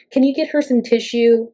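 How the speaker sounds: a hint of a fussy aide; mock request from staff for a tissue; imitating a handler. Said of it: "is what they would say".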